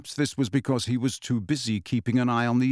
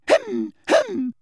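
very high, affected little throat-clearing; piercingly high mimicry of a fussy teacher; Professor Umbridge; 3 dB louder than usual. A narrator's reading. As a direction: interrupt with a prim, mocking cough noise. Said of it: "such a good imitation of Professor Umbridge".

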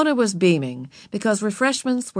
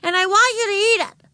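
put-on high, loud bossy little girl; indignant kid shouting; angry child; 4 dB louder than usual. A narrator's reading. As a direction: insist in a high-pitched tantrum voice. Girl's shouted tantrum continues.

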